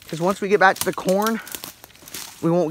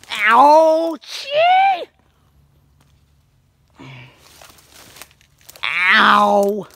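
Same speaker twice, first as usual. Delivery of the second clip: pained yelps while falling; sharp cry of pain; tripping and falling; 6 semitones higher, 8 dB louder, much slower than usual. Real, not acted. Falling in the woods, crying out.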